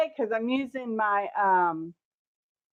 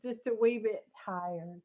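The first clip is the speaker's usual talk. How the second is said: quiet; 6 dB quieter than usual. Real, not acted. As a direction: keep it quiet and tired. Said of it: "I'm being quiet".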